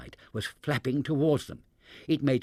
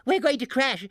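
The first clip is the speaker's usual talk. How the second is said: high, loud panicked scream; 13 semitones higher, 4 dB louder than usual. A narrator's reading. Barnaby's mother panicking as the balloon falls, loud scream. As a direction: scream in terror. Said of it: "screamed Barnaby's mother".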